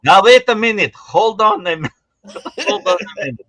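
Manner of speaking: put-on loud comic European accent, breaking into laughter. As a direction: protest in a mock accent. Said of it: "why don't we speak in accents".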